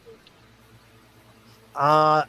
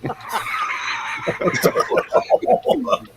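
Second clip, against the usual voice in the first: big laugh; 9 semitones higher than usual. Real, not acted.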